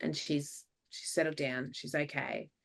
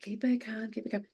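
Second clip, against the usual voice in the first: hushed urging; 4 semitones higher, 7 dB quieter than usual. Retold or imitated. Hushed instruction during crisis.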